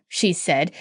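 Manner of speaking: ordinary delivery, nothing marked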